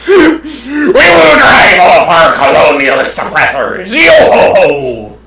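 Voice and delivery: slow, booming sea-dog rallying cry; hammed-up pirate proclamation, long drawn 'ho'. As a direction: roar it out like a cartoon pirate. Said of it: "It's a pirate voice".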